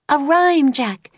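very high urgent call; yell. A narrator's reading. Annie yelling to Jack for a rhyme, very high. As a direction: call out across the snow. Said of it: "yelled Annie".

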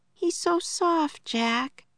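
high, slow cooing; high-pitched, drawn-out tenderness; tender wonder. A narrator's reading. Annie petting the baby kangaroo.

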